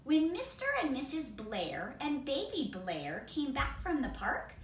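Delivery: ordinary delivery, nothing marked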